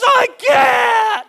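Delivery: put-on high, loud wailing child voice; crying child. Little daughter answers her dad, high, loud and wailing. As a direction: wail it like a hurt little girl. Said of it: "She says".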